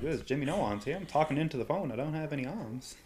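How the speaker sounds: lightly voiced sleazy exec, hushed; leering wheezy old man. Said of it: "It's like".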